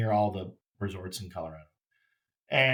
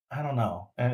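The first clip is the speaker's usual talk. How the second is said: ordinary delivery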